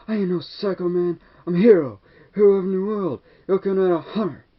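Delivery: a kid voicing a crazed movie psycho. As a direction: brag like an unhinged villain. Retelling psycho's boast.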